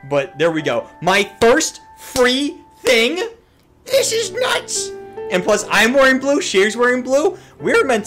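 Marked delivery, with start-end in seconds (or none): 1.0-4.9 high, loud, drawn-out squeal; thrilled disbelief. Real, not acted. Thrilled at first free reward. 5.3-8.1 high, loud and rising; giddy gushing. Real, not acted. Gushing about matching the character.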